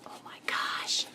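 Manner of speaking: slow, whispered exclamation; drawn-out whisper to oneself; dismayed whisper. Real, not acted. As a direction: whisper it slowly in disbelief. Dismay at her craft project.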